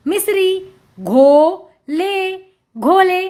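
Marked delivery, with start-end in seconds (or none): 1.0-2.4 घो and ले slow and separate; sounding out. Shown as a demonstration. Teacher reading to children, syllable by syllable. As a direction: stretch both halves: घो... ले. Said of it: "उच्चारण करके पढ़ लिया".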